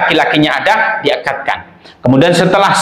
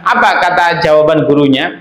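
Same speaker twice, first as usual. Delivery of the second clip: ordinary talk, nothing marked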